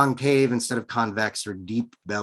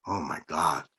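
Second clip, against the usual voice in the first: a startled blurt, softer than it is written; a lightly voiced gasp of shock; mild startled exclamation; blurted in surprise; 4 semitones lower, 3 dB quieter than usual. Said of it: "he blurted".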